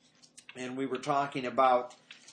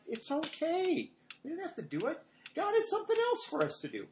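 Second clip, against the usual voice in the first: gentle reassurance; 14 semitones higher than usual. Retold or imitated. Father reassuring, slow and high.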